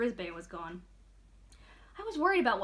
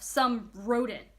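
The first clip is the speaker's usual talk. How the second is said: "Some rodent" said slow, lingering on "rodent" with distaste; slow, sneering "Some rodent"; disdainful rodent; 5 dB louder, much slower than usual. A narrator's reading. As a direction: drag out "rodent" like it's beneath her. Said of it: "Nobody says rodent quite the way".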